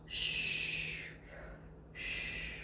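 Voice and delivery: soft whispered shh; hushing whisper. Acted. Shushing viewers so the dog can sleep, whispered. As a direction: hush the listeners, don't wake him.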